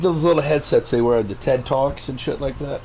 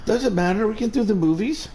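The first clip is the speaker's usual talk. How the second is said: ordinary delivery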